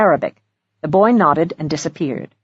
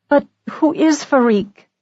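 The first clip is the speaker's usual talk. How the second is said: higher, slow, hesitant question; mild stammer; 8 semitones higher than usual. A narrator's reading. Young woman asking who Farik is, mildly stammering. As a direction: ask it haltingly, pitched high. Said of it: "stammered Amanda".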